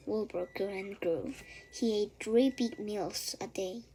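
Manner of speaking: plain reading voice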